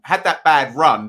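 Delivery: usual speaking voice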